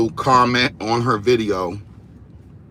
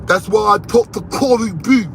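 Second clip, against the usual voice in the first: higher, louder mock Clarissa voice; imitating Clarissa; 8 semitones higher, 3 dB louder than usual. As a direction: put on her voice to mock how she talks. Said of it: "That's how she talks".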